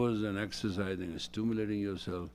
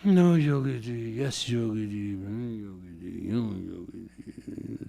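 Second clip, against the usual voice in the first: imitating pleading friend; 4 dB louder than usual.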